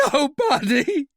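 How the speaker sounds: high, loud desperate denial; almost scream it out; near scream. Acted. Royale denying to Talbot, almost screaming. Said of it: "His voice was almost a scream".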